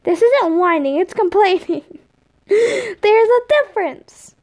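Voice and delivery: put-on high whiny kid voice; indignant kid. A narrator's reading. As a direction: argue back like an indignant child. Tom indignantly arguing back.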